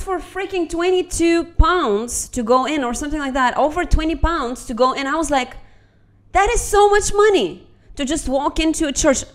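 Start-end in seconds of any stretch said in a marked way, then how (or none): 6.3-9.2 higher, louder retold indignation; indignant outburst. Telling viewers her reaction to the fee, mock indignant outburst. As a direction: protest the price, half-mock. Retold outrage at entry price.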